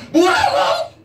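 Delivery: father explodes at his son; outraged shout. Acted. 아버지가 떠나겠다는 아들에게 화나서 소리치며.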